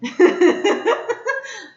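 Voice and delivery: a real high laugh breaking out; burst of laughter. Real, not acted. Teacher laughing at Emily's answer, a high burst of laughter. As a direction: laugh out loud at the silly answer.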